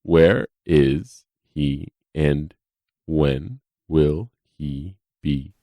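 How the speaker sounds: very slow, patronising; condescendingly slow questions to the deputy; deliberately slow. Acted. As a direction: spell it out slowly like to a child. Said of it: "I will take this slow for you".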